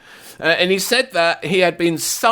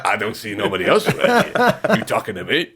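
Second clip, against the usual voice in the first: bad De Niro impression. Pundit mocking a player with a laughing, hammed-up De Niro voice. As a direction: lightly ham up a Taxi Driver De Niro. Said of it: "the worst Robert De Niro impression that's ever been done".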